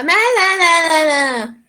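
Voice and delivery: put-on high, drawn-out baby babble; baby babbling. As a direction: babble like an infant, stretching each syllable. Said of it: "que el niño está". (balbuceo de bebé agudo y alargado)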